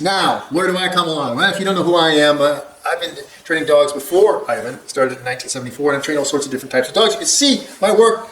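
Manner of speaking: ordinary delivery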